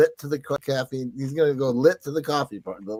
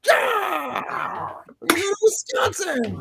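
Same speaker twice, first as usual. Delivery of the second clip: high, cracking joke shout; mock rally yell; much slower than usual. Host hams up a rally cry to cohost. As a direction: yell it like a crazed campaign rally.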